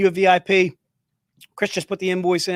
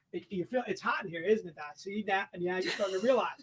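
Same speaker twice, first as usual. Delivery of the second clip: much softer, teasing murmur; quiet teasing aside; 14 dB quieter than usual. Real, not acted. Host quietly teasing Doc about the hot room, soft. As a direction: needle him softly about the heat.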